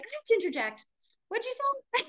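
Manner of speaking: interrupting the conversation in disbelief; high incredulous. Retold or imitated. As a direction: burst in, what'd you say. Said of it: "I finally was like".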